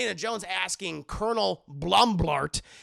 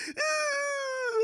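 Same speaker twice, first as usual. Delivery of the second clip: high whimpering wail; mock cry. Kate sees the eyeball soup and cries in a high mock whimper. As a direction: whimper it high like a fake sob. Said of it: "and she cries".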